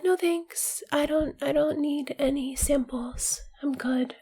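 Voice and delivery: soft, breathy whispered decline; a hint of shy refusal. Starving shopper whispering a refusal to samples. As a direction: turn it down meekly.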